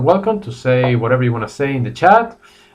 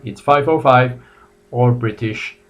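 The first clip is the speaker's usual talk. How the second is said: usual speaking voice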